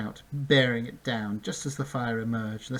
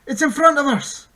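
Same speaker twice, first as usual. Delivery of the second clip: loud, much higher panicked shout; panicked cry; 12 dB louder than usual. A narrator's reading. Bink warning the centaur, loud and panicked. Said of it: "Bink cried in panic".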